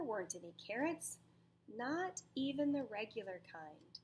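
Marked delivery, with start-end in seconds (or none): none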